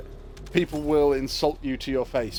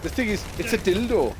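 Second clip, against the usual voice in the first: put-on Welsh accent; 5 semitones higher than usual. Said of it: "you do it with the accent".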